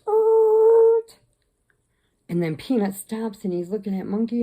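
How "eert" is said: high, loud drawn-out screech; imitated animal screech. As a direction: screech like a startled pet. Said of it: "Monkey goes".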